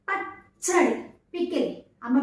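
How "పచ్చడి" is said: పచ్చడి with a held catch before చ; pressed double చ. Shown as a demonstration. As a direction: press firmly into the చ్చ of పచ్చడి. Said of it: "చాకి చా వస్తుంది".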